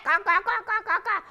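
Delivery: a crow's squawks; crow caws. Acted. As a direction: caw like a crow. Crows cawing.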